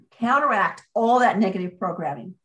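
ordinary talk, nothing marked

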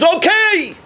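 God answering the shofar, high and exultant; joyful Hashem. Acted. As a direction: announce mercy with joy. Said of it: "and Hashem says".

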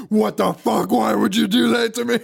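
high-pitched, hammed-up outrage; exasperated shriek, played for laughs; mock furious. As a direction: imitate him at his angriest. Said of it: "it's the angriest he gets in the movie".

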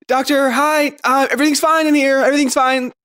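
high, loud, panicky cover-up; flustered. Acted. Flustered daughter hides from doctor.

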